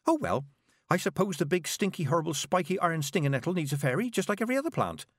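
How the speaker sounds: put-on high, hesitant young girl; girl reporting to the witches; nervous girl. A narrator's reading. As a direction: stammer out the news shyly. Petulia accepting.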